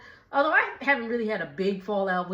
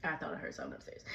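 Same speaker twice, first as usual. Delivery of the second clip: hushed, quick whisper to herself; whisper aside; 6 semitones lower, 18 dB quieter, much faster than usual. Real, not acted. A vlogger startled by a noise upstairs, whispering. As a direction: drop to a sudden whisper.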